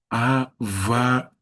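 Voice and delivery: Havaí syllable by syllable, ending on a held Í; slow demonstration of Havaí, final vowel drawn out; stress on final Í. Shown as a demonstration. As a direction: spell out the sounds of "Havaí", stretching the last Í. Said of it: "qual é o acento?".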